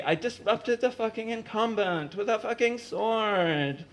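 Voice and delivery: 1 exasperated. Acted. Umeko's exasperated confession.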